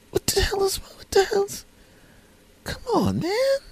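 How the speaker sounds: extremely high falsetto disbelief; hammed-up squeaky dismay, dwindling at the end; mock appalled pleading. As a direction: squeal the scolding, then fade into faint exasperation. Friends keep scolding him, appalled.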